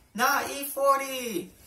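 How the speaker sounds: high, slow correction; insistent coworker. Acted. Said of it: "like, a language barrier".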